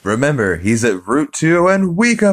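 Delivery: high, loud, hyped announcer voice; ad announcer tag. Retold or imitated. Commercial announcer shouting the store's location. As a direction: hype up the address like a TV ad. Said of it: "the announcers on those commercials".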